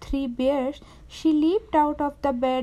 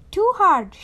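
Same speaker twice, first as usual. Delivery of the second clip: louder, higher storybook grumble; acted grumpy objection; grumbled complaint; 5 semitones higher, 7 dB louder than usual. A narrator's reading. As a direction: complain emphatically. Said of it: "she grumbled".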